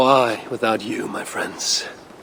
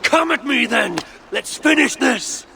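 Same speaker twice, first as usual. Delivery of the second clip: defiant shout; 10 semitones higher than usual. Acted. Hero dares the fleeing witch to finish the fight, defiant shout. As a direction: roar the dare at her.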